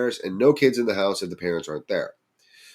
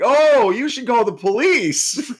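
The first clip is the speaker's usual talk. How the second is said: much higher, louder, slow fake-earnest advice; mock concern; 6 dB louder, slower than usual. Teens mock-advise the scared kid on the phone. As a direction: pretend to be helpful while joking. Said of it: "And we're like".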